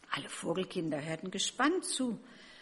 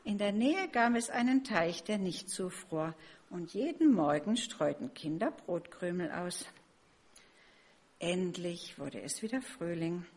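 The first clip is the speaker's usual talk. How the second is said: ordinary delivery, nothing marked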